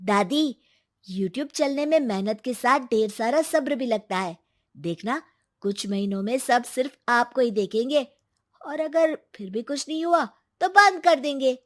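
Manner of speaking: put-on reassuring young boy's voice; encouraging grandson. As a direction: urge grandma to be patient.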